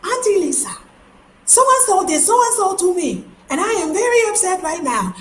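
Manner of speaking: put-on high, whiny little boy tattling to his aunt; upset nephew. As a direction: pitch it way up like a kid telling on someone. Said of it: "And so he got mad".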